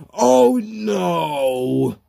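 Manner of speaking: high, wailing shout of dismay; long, high-pitched gamer wail; dismayed cry. Acted. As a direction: cry out as it all goes wrong. Loses the mushroom in the game.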